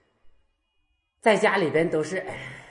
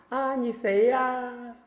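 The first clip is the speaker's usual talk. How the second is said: high, slow, drawn-out puzzlement; senile old devotee; 9 semitones higher, much slower than usual. Retold or imitated. Old devotee fails to recognize the master, befuddled. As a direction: stretch it out like a confused old person. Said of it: "就像痴呆一样的".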